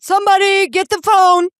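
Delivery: imitating the uncle, high and loud, startled. Deaf uncle mistaking a fart for the phone, startled high shout. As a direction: blurt it like you heard a phone ring.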